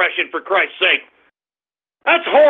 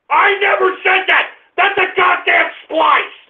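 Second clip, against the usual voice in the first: high-pitched outraged yelling; real angry outburst; 6 semitones higher than usual. Real, not acted. Host denying a spliced clip of himself, angry high yell. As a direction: protest it at the top of your range, indignant.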